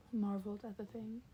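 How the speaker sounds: ordinary delivery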